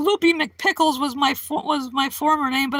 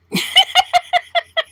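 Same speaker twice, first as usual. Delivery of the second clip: high laugh; 14 semitones higher, 4 dB louder than usual. Real, not acted. Laughing at British actors.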